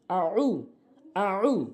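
আ উ with a throaty ayn; উ pressed from the throat; Arabic ayn, not hamza; ayn sound. Shown as a demonstration. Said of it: "এখানে আইন রয়েছে".

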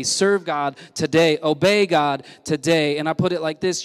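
ordinary talk, nothing marked

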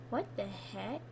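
very soft, low question; quiet, low disbelief with a rise; hushed bewilderment. Real, not acted. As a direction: say it under the breath, low. Low reaction after scare.

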